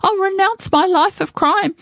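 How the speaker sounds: high-pitched desperate plea; begging. Acted. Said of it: "Another begged".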